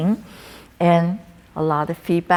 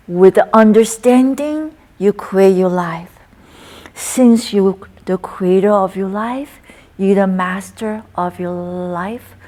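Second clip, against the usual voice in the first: recited qigong poem; 4 dB louder than usual. Acted. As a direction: intone each line as a healing verse.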